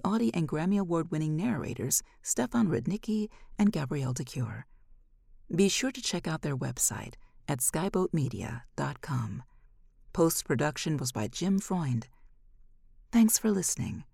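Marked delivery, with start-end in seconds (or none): none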